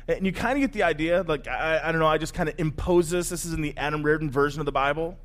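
ordinary talk, nothing marked

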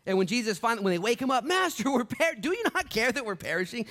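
high, loud panic; panicked disciples. Acted. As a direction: cry out as if drowning. Storm scene preached.

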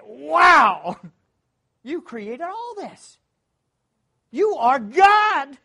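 loud, slow, high exclamations of awe; awed praise on the bridge. Retold or imitated. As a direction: marvel out loud at creation.

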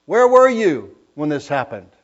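God demanding answers from Job, loud and commanding; God's thunderous challenge. Acted. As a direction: call him out like an angry God. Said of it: "he humbles Job before God".

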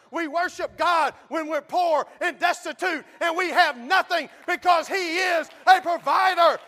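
loud, high, shouting sermon; fiery preaching. Acted. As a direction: preach it with full force. Preaching at full force.

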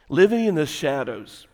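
ordinary talk

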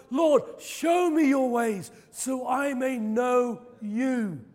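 loud, slow, drawn-out plea to the Lord; fervent preacher quoting a prayer; impassioned declamation. Acted. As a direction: cry out the scripture, stretching each phrase.

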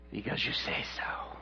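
very low, hushed surrender; resigned obedience. Acted. Peter yielding to Jesus, hushed and resigned. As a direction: give in softly, almost whispered.

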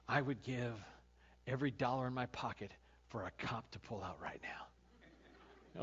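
whisper. Real, not acted. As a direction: lean in and whisper the mean wish. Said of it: "confessing a mean wish".

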